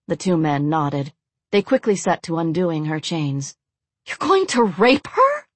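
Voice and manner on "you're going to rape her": high, shocked gasp. A narrator's reading. Blonde horrified for the other captive, gasping. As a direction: gasp the question in horror. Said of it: "the blonde gasped".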